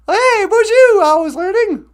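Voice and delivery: squeaky puppet greeting. Pretend. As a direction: greet like a tiny excited puppet. High greeting voice for viewer.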